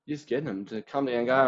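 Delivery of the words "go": ordinary talk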